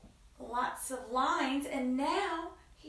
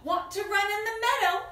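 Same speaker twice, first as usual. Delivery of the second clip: high, loud sheep's invitation; storybook sheep; 9 semitones higher, 5 dB louder than usual. Acted. Sheep invites the spider to the meadow, high sheep voice. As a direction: lift the voice as a sheep asking to play. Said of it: "along came a sheep".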